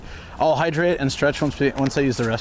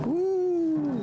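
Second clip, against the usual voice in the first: a much higher joyful whoop; excited whoop; 5 dB quieter than usual. Real, not acted. Sticking his head out the car window, a high joyful whoop. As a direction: let out a happy cheer into the wind.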